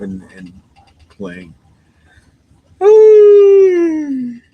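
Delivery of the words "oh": big loud yawn. Real, not acted. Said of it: "makes me yawn".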